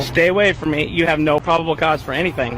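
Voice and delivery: loud, firm pushback at a cop; angry. Real, not acted. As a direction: tell him to back off, loud. Confronting cop, raised voice.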